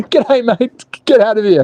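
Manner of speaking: a cartoon Australian greeting for the Aussie viewer; mock Australian. Australian accent greeting an Aussie viewer in Dubai, mock. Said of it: "Aussie viewer greeting".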